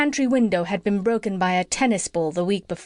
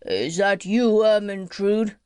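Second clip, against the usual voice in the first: sleepy king; slower than usual. A narrator's reading. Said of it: "said sleepily".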